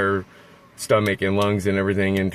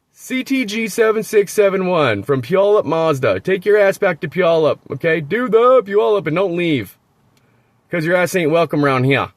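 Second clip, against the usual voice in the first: raised, high-pitched irate rant; heated, pitched-up warning to stay away; angry telling-off; 6 semitones higher, 4 dB louder than usual. Real, not acted. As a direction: call out the offender, indignant and forceful. Angrily telling other driver off.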